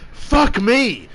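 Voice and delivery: put-on a high, strained curse from a flustered young cook; stressed-out chick. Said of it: "and she's all stressed out, and she just goes".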